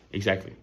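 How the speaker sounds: "exactly" said in a reduced, everyday way; quick, relaxed "exactly"; casual pronunciation. Shown as a demonstration. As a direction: let the middle of "exactly" go loose. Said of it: "I say exactly".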